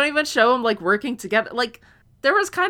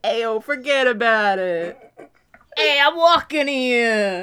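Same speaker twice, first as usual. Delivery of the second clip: put-on slow, drawn-out New York accent; exaggerated New York street-guy accent; New Yorker accent; 3 semitones higher, 4 dB louder, slower than usual. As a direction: stretch each word like a movie New Yorker. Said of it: "I wish he was so much more New Yorker".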